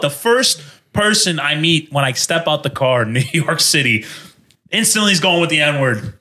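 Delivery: ordinary talk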